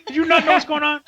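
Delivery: high, loud incredulous scolding; mock-exasperated fan. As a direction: scold them in disbelief. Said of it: "And I was like".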